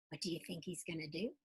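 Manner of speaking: ordinary delivery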